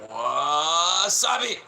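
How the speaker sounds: slow, high, loud shout of "Wasabi!"; mock Japanese shout. Mocking Japanese speakers, drawn-out loud yell. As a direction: stretch "Wasabi!" out in a hammed-up yell. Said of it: "You don't hear Americans talking like that".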